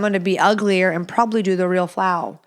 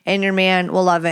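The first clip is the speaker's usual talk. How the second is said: ordinary delivery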